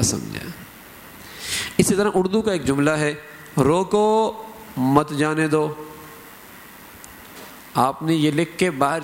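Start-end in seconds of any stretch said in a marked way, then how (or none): 3.6-5.7 روکو drawn out and raised, a break, then مت جانے دو; pause after روکو. Shown as a demonstration. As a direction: stop on روکو, then say مت جانے دو as one phrase. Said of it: "پر وقف کیا". (روکو پر وقف، پھر مت جانے دو)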